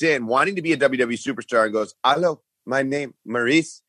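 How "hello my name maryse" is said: mock French-accented broken English, a bit higher; imitating a French speaker's halting English; lightly put-on Montreal French accent. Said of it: "She didn't speak English at all".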